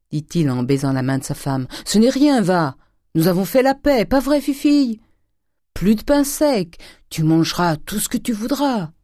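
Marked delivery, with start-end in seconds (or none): 1.8-8.9 loud, high, hearty reassurance; jolly them along emphatically; Grandet, coaxing. A narrator's reading. Father making peace with his daughter, forced coaxing cheer.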